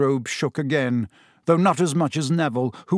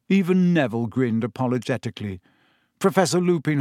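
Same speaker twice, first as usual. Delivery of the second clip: usual speaking voice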